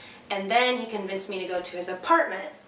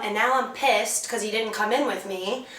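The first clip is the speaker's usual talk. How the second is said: usual speaking voice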